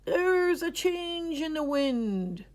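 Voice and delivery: put-on high, slow, grand old grandpa voice; solemn old grandpa announcing; announced. A narrator's reading. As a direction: make a weighty pronouncement. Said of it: "he announced".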